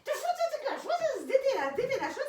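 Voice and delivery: laughing what-on-earth at the pet; amused disbelief. Real, not acted. (крізь сміх, високо здивовано)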